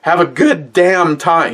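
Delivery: corrected take, with the word "damn"; worked-up grandpa, corrected. Retold or imitated. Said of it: "He didn't say fuck".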